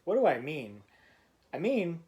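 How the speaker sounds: plain reading voice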